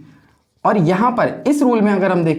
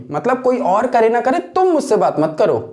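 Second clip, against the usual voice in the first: usual speaking voice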